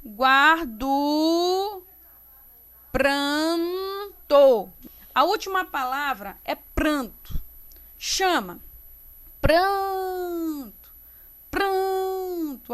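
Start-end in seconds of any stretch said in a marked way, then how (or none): 0.1-4.7 GUARDO slow, ending in a long "du"; drawn-out scansion, PRAN held long; syllables with O as U. Shown as a demonstration. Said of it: "tem som de U". 9.4-12.7 PRANTO called high with a long PRAN; higher, sustained stress on PRAN; stressed syllable stretched. Shown as a demonstration. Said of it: "A sílaba tônica".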